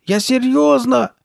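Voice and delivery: very high, loud, drawn-out shout; slow shrieked insistence; crying out. A narrator's reading. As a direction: yell it desperately at a high pitch. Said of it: "закричала Карли Бет".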